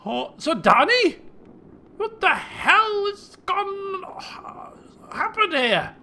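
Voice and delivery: loud, high, drawn-out alarm; shocked father. Acted. King finding his daughter in the wrecked chamber, shocked. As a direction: stretch it out in stunned disbelief.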